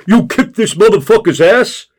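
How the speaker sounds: loud, high, impressed question; excited giant. A narrator's reading. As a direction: ask it thrilled and loud. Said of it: "positive response from giant".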